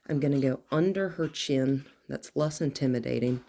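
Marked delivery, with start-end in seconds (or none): none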